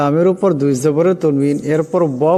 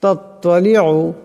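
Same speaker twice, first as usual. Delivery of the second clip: slow melodic tajweed chant, drawn-out vowels; measured, melodic Quranic recitation; much slower than usual. Shown as a demonstration. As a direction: recite it slowly as a tajweed lesson. Teacher recites the verses again.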